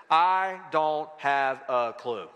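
slow, deliberate, each word set apart. Real, not acted. Preacher giving congregation his five-word answer, slow and deliberate. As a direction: spell it out one word at a time.

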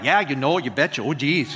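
mock the Minnesota locals with a hint of their accent. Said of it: "that's how they talk".

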